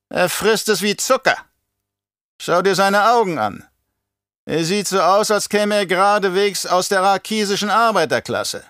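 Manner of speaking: high, haughty baron voice; belehrender Baron. Acted. Baron assesses the Mentat. (hohe Baronstimme, herablassend belehrend)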